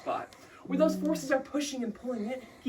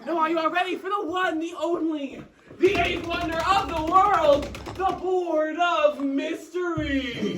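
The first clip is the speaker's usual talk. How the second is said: slow, louder, higher showman reveal; grand announcer; 6 semitones higher, 5 dB louder, slower than usual. Acted. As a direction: announce it like a circus act.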